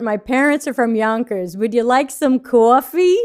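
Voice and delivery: put-on comic Yonkers accent; New York accent. Reading a listener's comment for laughs, New York accent. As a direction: read it with broad New York vowels on coffee. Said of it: "word on accents. They're great for comedy".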